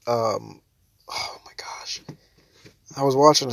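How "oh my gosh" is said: breathless whispered oh my gosh. Real, not acted. Headache hurting while laughing, whispered. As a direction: whisper it through the pain.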